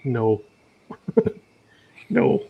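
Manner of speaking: ordinary talk, nothing marked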